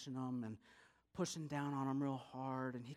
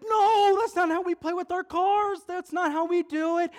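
frantic protest; 17 dB louder than usual. Acted. Boy yelling at cousin dumping cars, frantic protest. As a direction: shout it as a child's outraged objection.

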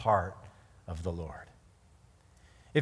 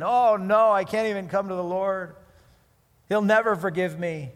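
louder, higher panicked wail; hammed-up despairing believer, louder and higher; mock despair; 6 semitones higher, 7 dB louder than usual. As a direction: ham up the sinner's panic.

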